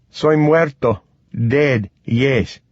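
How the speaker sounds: put-on halting bilingual answer in a South American accent. A narrator's reading. Bitten man accepting his fate, South American accent. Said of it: "in a thick South American accent".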